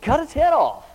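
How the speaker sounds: high, loud order; loud command. Retold or imitated. Father yelling at his daughter to kill the snake, loud and high. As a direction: bark it out over the pinned snake. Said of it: "I said".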